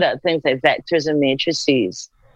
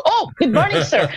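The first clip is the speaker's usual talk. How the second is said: much higher, laughing greeting; flustered surprise; 12 semitones higher than usual. Retold or imitated. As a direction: blurt it out, caught off guard and laughing. Realizes the Prime Minister is calling.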